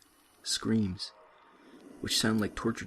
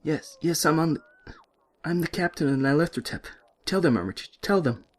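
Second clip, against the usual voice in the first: louder, higher, pleading urgently; frantic captive pleading loudly; desperate protest; 7 semitones higher, 4 dB louder than usual. Acted. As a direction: beg them to believe you.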